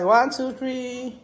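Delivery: high, stretched-out count; slow sing-out countdown; photo countdown. Real, not acted. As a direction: draw out each number for the camera.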